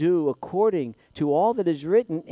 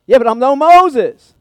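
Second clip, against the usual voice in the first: higher and much louder, insisting in alarm; loud panicked protest; 8 semitones higher, 12 dB louder than usual. Retold or imitated. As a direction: Joshua objecting in fear to God. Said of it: "that he was afraid".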